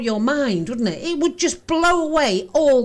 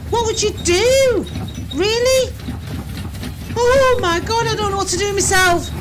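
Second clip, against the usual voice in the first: panicked; 8 semitones higher than usual. Real, not acted. Panicking at the footage.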